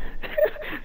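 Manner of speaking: very high, soft giggle; high-pitched laughing. Real, not acted. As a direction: giggle at your own tease. Laughs after teasing a friend.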